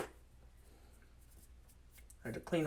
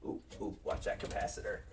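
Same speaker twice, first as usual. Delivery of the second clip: whispering; 7 semitones lower, 7 dB quieter than usual. Real, not acted. Careful reassembly near capacitor.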